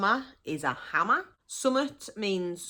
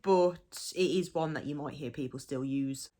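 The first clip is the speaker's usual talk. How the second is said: usual speaking voice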